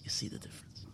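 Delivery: whispered question; hushed, secret-telling whisper. Shown as a demonstration. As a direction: lean in and whisper it.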